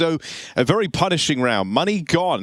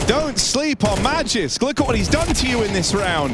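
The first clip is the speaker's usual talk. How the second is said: excited play-by-play; 9 semitones higher than usual. Real, not acted. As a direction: hype up the player's round. Hyped play-by-play.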